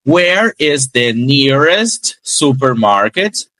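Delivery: where is the nearest supermarket, very slow but in one breath; very slow linked demo. Shown as a demonstration. Teacher showing a single flowing phrase. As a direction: draw it out without stopping. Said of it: "Olha como vai numa fala única".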